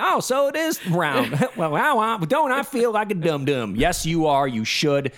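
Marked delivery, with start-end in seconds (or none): 0.0-3.8 higher, dopey dawning realisation; mock realising flat-earther. Host voicing the bassist seeing Earth from space, mock realisation. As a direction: play a sheepish dimwit working it out. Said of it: "Just let him get an idea".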